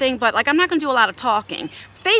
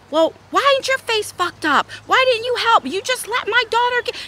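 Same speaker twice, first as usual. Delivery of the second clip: high, accusing retold outburst; pissed off; 11 semitones higher than usual. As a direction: act out laying into the daughter's friend. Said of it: "like, and I'm pissed".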